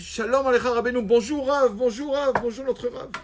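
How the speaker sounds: high, loud festive welcome; high loud welcoming crowd; joyful crowd greeting. Acted. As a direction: cheer the rabbi in as the whole town. Townspeople greet the tzaddik.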